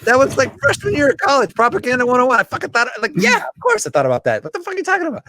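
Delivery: high, loud incredulous reply; exasperated mock retort. Scene: answering a condescending relative, high loud exasperated scoff. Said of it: "And you're like".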